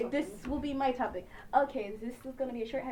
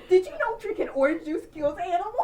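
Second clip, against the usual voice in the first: high mock preachy kid; 6 semitones higher, 3 dB louder than usual.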